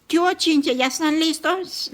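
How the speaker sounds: mother voicing her son arriving to fetch the kids, mock-cheerful call; cheerful greeting. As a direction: call out happily to the little ones. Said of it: "llegaba él de una vez".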